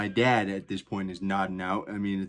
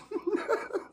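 high giggle; 4 dB quieter than usual. Real, not acted. Laugh after dad impression.

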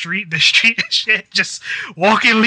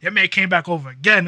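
ordinary talk

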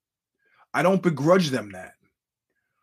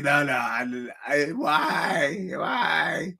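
hammed-up crying shout; mock wail; much slower than usual. Friend lost and crying, mimicked as a sobbing shout. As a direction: wail the why like a sob. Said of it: "he's crying".

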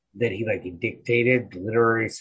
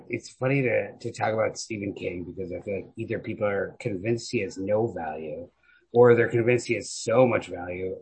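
ordinary talk

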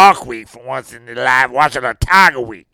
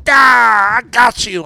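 Saban gloating; 6 semitones higher, 4 dB louder, slower than usual. Retold or imitated. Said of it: "And then he goes".

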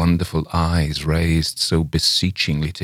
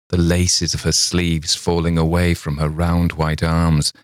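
ordinary delivery, nothing marked

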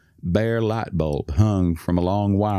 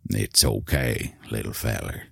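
gentle soothing; 9 semitones lower, 5 dB quieter than usual. Acted. Old man soothing hurt toddler.

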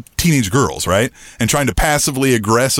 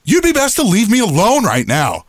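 higher-pitched retold warning; firm warning; 7 semitones higher than usual. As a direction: insist pointedly without shouting. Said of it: "I was letting her know".

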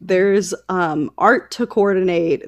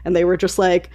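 ordinary talk, nothing marked

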